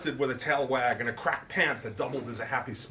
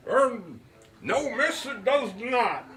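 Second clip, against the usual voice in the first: put-on higher, louder, grand old gentleman; Root's voice; 11 semitones higher, 5 dB louder, slower than usual. A narrator's reading. Root lectures his guest on the magic word, higher and grand. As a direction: pronounce it like a showman, slowing on the magic word.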